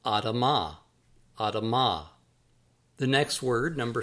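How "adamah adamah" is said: "Adamah" said as ah-dah-MAH; Hebrew pronunciation. Shown as a demonstration. Author teaching readers a Hebrew word, careful ah-dah-MAH. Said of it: "that is pronounced".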